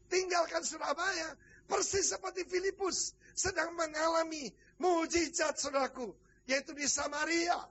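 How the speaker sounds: high, ringing revival preacher delivery; fervent preaching. Real, not acted. Preacher recalling the revival in Surabaya, fervent preaching. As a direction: proclaim it fervently to the whole congregation.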